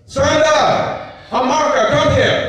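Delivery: a raging king calling his servants; furious summons. Acted. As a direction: roar for them across the palace. Enraged king summons the teachers.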